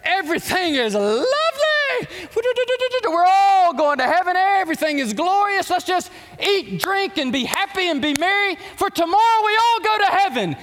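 high, loud, gushing sermon in mockery; sarcastic sing-song preaching; mocking feel-good preacher. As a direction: parody a preacher who only tells happy news. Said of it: "just get up there and say".

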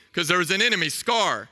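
normal talking voice

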